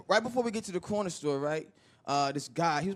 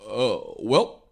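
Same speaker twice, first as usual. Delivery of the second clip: Obama; 4 dB louder, much slower than usual. Acted. President dodging a question about bombs, unhurried smooth evasion. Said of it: "Obama lied so good".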